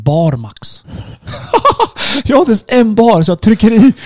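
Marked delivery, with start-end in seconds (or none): 0.9-2.2 a short airless laugh at her own bad luck; breathy high laugh. Real, not acted. Laughing to a friend about the flat tyre, high and airless. 2.2-3.9 said high and louder through laughter; laughing. Real, not acted. Retelling the tyre mess to a friend, laughing.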